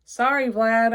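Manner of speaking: calling out sorry, loud and high; called out. Acted. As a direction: call out an apology across the room. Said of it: "I called".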